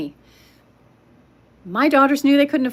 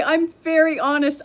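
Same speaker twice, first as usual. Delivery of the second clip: ordinary talk, nothing marked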